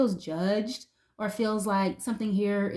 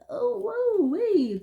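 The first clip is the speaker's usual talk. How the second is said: sharp high whoa; startled by the cards; surprised; 12 semitones higher, 4 dB louder, much slower than usual. Real, not acted. Reacting to the card combo.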